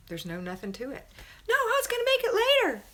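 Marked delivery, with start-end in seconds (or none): 1.5-2.8 high, loud mock whine; hammed-up child's wailing protest. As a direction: yell back like a kid making excuses.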